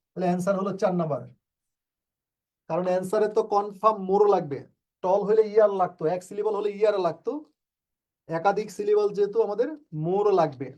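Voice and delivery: usual speaking voice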